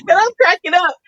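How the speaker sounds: laughing through the words. Real, not acted. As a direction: giggle it out. Laughing while telling.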